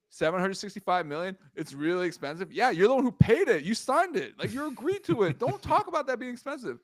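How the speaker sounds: high, loud incredulous outburst; can't believe he's complaining; exasperated rant. Real, not acted. Ranting at Steve Cohen's complaint.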